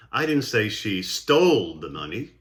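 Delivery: "stole" hit hard and stretched; single out "stole" with strong emphasis; stress on STOLE. Shown as a demonstration. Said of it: "stressing of certain words".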